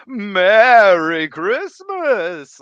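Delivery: loud, slow, drawn-out Merry Christmas; hammed-up Santa call; retold Santa greeting. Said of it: "I try to say".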